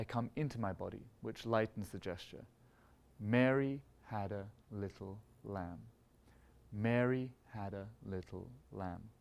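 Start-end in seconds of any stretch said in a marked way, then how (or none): 3.2-5.8 "Mary" sung out heavy, rest lighter and slow; slow, stress on MARY. Shown as a demonstration. Conductor sings the phrase while beating heavy on Mary. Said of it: "Big amplitude on the emphasized beat of Mary". 6.7-9.1 "Mary" sung out heavy, rest lighter and slow; slow, stress on MARY. Shown as a demonstration. Conductor sings the phrase while beating heavy on Mary. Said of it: "Big amplitude on the emphasized beat of Mary".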